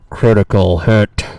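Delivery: slow, booming crit announcement; dramatic announcer. Acted. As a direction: call it out like an arena announcer. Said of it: "It's not the same".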